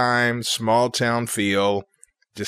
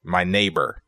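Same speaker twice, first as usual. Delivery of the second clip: normal talking voice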